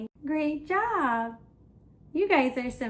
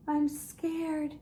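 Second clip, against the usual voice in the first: softer, timid mock fear; scared; 6 dB quieter than usual. Shown as a demonstration. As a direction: act a small, quiet fright. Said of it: "I'm really scared".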